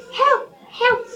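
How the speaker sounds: high, thin calls for help; a child calling out high for help; cry for help. Acted. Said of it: "came a faint cry".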